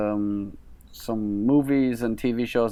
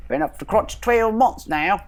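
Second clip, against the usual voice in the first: hammy London Cockney voice; Cockney accent; 10 semitones higher, slower than usual. Acted. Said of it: "terrible Cockney accent".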